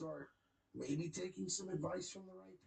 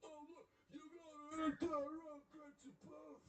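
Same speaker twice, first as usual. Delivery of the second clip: higher, sing-song mock voice; higher mocking tone, imitating a streamer; mocking impression; 11 semitones higher, 4 dB quieter than usual. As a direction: parody his dismissive reply. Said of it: "he'll be like".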